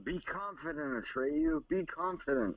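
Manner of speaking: soft encouragement. Real, not acted. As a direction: gently coax the cat to jump. Urging the cat to jump.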